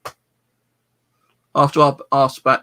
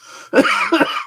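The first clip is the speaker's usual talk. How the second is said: harsh cough; 6 dB louder than usual. Real, not acted. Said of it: "incense is killing my throat".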